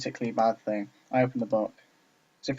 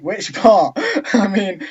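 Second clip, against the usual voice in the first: high, loud and cracking up; laughing; 7 semitones higher, 9 dB louder, much slower than usual. Real, not acted. Reader breaks up during Natsuki's reply, laughing.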